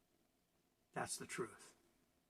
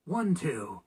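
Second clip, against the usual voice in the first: ordinary delivery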